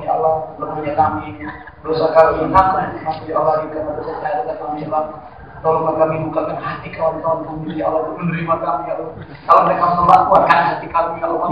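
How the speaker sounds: soft, hushed pleading prayer to Allah; humble prayer. Acted. Preacher voicing his prayer for hearts to open, soft pleading. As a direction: pray low and humbly, asking for help.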